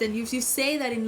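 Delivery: usual speaking voice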